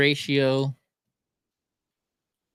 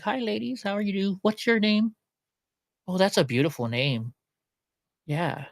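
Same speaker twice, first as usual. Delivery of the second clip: sweet-talking charmer; 3 semitones higher than usual. Pretend. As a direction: lightly play a smooth host chatting up ladies. Pretend chatting up women with dog.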